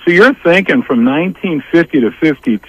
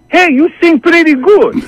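much higher, lightly voiced proud dad; a hint of the impressed father, pitched up; dad complimenting; 13 semitones higher than usual. The dad praises [name]'s singing, pitched-up admiring voice.

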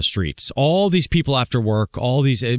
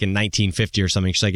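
ordinary talk, nothing marked

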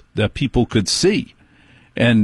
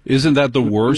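mock dismay; 2 semitones higher than usual. Host commiserates in funny voice.

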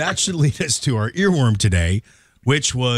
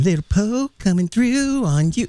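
high, put-on taunting voice; sun character voice; 8 semitones higher than usual. Voicing the sun.

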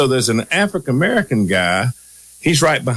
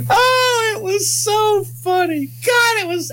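high laughing cry. Real, not acted. Laughing at the memory.

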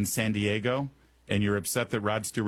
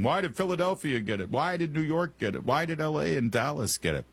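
mock whining complaint; 6 semitones higher than usual. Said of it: "everyone was complaining".

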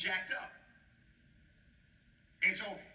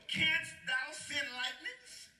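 slow, drawn-out, higher and laughing; voice of God; 8 semitones higher, much slower than usual. Acted. God challenging Job through the preacher, slow and higher. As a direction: stretch it out like a thundering God.

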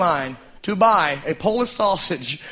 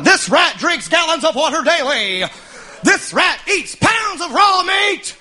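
barker yell; 4 semitones higher than usual. Retold or imitated. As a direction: sell the rat to passers-by at full volume. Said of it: "And he kept going".

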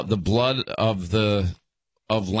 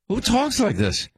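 high-pitched, exasperated disbelief; incredulous; 11 semitones higher than usual. Real, not acted. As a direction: ask it high, appalled at the remarks. Disbelief after reading offensive remarks.

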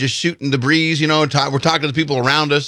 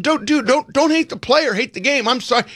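high apologetic pleading; smooth it over with a plea; placating plea; 8 semitones higher than usual. Retold or imitated. Narrator placates angry line man, pleading.

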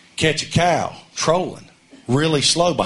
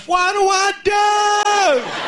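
panicked wife. Retold or imitated. Wife hooked a cow yells at husband, high panic. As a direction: shout for help in a panic. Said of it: "Looks over at me and goes".

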